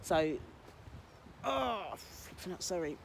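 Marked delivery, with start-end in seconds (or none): 1.4-3.0 slow, soft gasp through real sudden foot pain; "oh, flipping out" forced out softly as the pain hits. Real, not acted. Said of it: "sharp shooting pain through my foot".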